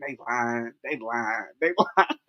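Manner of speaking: low, hushed mutter in your head; sing-song suspicion under your breath; inner suspicious voice. Retold or imitated. Thinking about a lying relative, a hushed suspicious inner voice. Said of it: "you be thinking".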